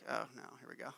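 hushed sinking dread. Retold or imitated. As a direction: brace for trouble under your breath. Inner dread when kid looks upset.

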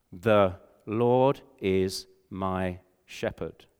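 very slow, one word per finger. Shown as a demonstration. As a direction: count each word out slowly on the fingers. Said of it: "assigning a word to each finger".

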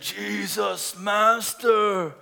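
drawn-out wailing leper plea. Acted. Lepers calling to Jesus for mercy, in a drawn-out leper voice. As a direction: stretch each word like a pleading leper. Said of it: "That's my leper voice".

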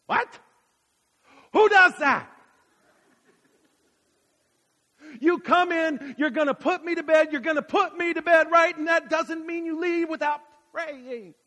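high, loud mock outrage; hammed-up disbelief at the mom; retold indignation.

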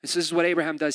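ordinary talk

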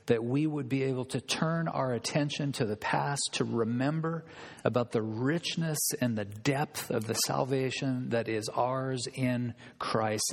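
low, measured prayer voice; subdued pastoral prayer. Real, not acted. Opening prayer before preaching.

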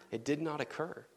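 usual speaking voice

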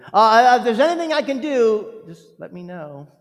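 high, loud breezy offer fading off; mock platitude. Mocking what churchgoers say to the grieving. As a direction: parody the empty polite offer. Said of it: "what a lot of us say".

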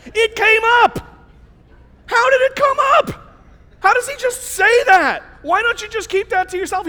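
high, loud comic disbelief; incredulous. Acted. Preacher marvelling that Jonah just said it, incredulous. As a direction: exclaim it, squeaking up in amazement.

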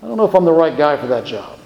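hesitant, self-doubting reply; reluctant prophet. Acted. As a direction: hedge your way out of the job. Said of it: "the prophet responds".

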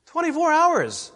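high-pitched, forceful repeat; incredulous exclamation. Real, not acted. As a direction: throw it out high in disbelief. Repeats answer for rhetorical effect.

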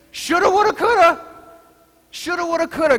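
singsong chant, high and lilting; broad singsong kids theatre. Retold or imitated. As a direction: chant it like a playful rhyme. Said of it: "we would say".

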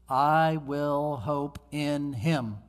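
very slow, measured words; solemn resolve. Acted. Job's resolve after a pause.

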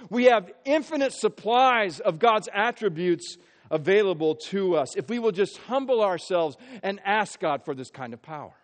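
ordinary talk

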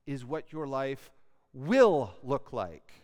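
"will" lent et appuyé, en descendant; stress on will. Shown as a demonstration. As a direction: appuie fort sur "will", en ralentissant. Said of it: "Why do I emphasize the word will?".

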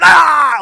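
loud, high exasperated shout; a sharp cry of giving up; frustrated yell. Retold or imitated. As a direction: let out a burst of frustration. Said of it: "I was like".

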